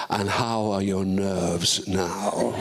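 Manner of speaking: put-on slow, amused old actor asking kindly; a lightly voiced, teasing veteran actor, slowed right down; imitating Peter O'Toole. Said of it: "and he said".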